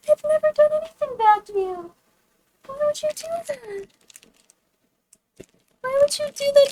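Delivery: very high-pitched wounded pleading; distraught. Real, not acted. Streamer asking Michael why he ruined the Uncrustable, distraught. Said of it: "You sound distraught".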